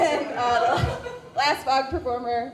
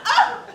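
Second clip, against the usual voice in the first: high loud hammed-up yell; mock anguished cry; 13 semitones higher, 11 dB louder than usual. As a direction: let out a melodramatic shriek. End of Godfather parody.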